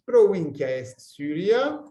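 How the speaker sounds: slow, loud Latin with rising end; slow prompting repeat. Shown as a demonstration. Teacher gets the lost student to repeat, slow rising prompt.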